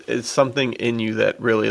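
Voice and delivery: ordinary delivery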